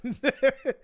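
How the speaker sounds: loud burst of real laughter. Real, not acted. Streamer recalling Skyrim kills to chat, bursting out laughing. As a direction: crack up out loud at the memory. Recalling killing an NPC's wife.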